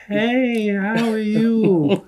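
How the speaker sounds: high, drawn-out put-on friendly greeting; dad's switched voice. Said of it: "He changes his voice actually".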